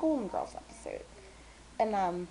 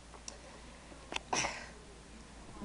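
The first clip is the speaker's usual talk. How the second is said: soft exasperated breath out; long weary sigh; 7 dB quieter than usual. Real, not acted. Fed up with pretentious talkers, long weary sigh.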